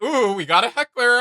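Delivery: high, loud playful quote of the heckler line; imitating Sona. Streamer imitating the character teasing a heckler, playful. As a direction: tease the heckler in a bright mock voice. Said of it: "she's like".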